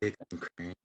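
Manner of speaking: low, husky sultry announcer. Pretend. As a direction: purr the name like a seductive host. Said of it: "I'm gonna have to put on a sultry voice".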